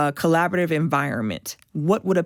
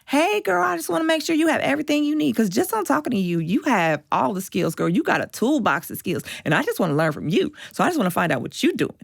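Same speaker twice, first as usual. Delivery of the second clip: lightly voiced Tennessee accent, high and fast at the start; a friendly Southern girl calling her up; 7 semitones higher than usual. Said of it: "she's got this thick Tennessee accent".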